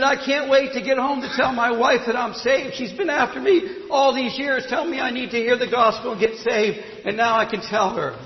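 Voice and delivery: high, loud, overjoyed outburst; so excited. Retold or imitated. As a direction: burst out with the good news. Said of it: "the man was so excited".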